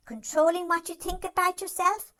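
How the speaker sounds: usual speaking voice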